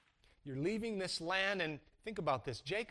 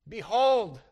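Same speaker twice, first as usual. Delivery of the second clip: Behold said loud and drawn out, falling; emphatic behold; 7 dB louder than usual. Shown as a demonstration. Preacher to congregation, Behold stressed loud. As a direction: lean hard on Behold. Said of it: "Can't pass that word up".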